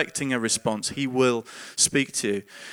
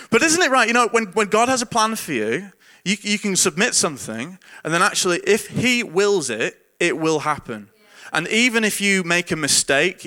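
higher, louder rousing preaching; 5 semitones higher, 4 dB louder than usual. Acted. Pastor rallying his listeners about God's plan, in full preaching voice. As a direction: lift the congregation with conviction.

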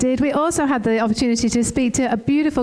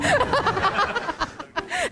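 high burst of laughter; 10 semitones higher, 5 dB quieter than usual. Real, not acted. Laughing after joking on stage.

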